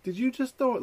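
high mock Karen question; mock accusing lady. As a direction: accuse him like the neighbor lady. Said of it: "she was like".

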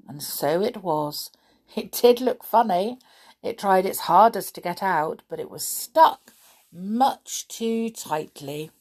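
ordinary delivery

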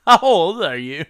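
loud, high mock disbelief; incredulous shout. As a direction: blurt it out in disbelief. Mocking crew reaction.

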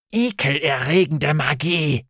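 understated disgusted fury; contained rage; seething anger. A narrator's reading. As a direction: spit it out, barely able to speak for anger. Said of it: "so zornig, dass sie kaum sprechen konnte".

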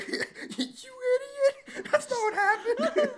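very high, loud laughing screech; mock outraged shriek. [name] and [name] correcting Whit, mock outraged shriek.

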